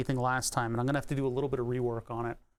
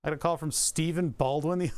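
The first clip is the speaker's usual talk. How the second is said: ordinary delivery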